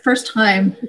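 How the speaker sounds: normal talking voice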